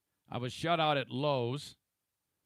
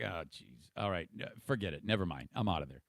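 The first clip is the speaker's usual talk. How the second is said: much lower and softer, giving up; deflated; 8 semitones lower, 6 dB quieter, faster than usual. Retold or imitated. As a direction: mumble it like he's given up. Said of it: "And I'm like".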